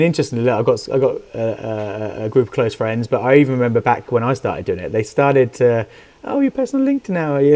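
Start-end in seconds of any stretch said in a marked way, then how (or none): 6.3-7.6 much higher sing-song teasing; teasing mock. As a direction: poke fun like a smug friend. Friends teasing about posting.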